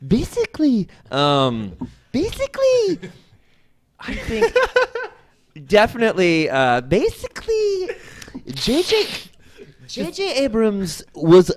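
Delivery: nerd voice. Pretend. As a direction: put on a squeaky nerd voice. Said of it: "Can you do your nerd voice?".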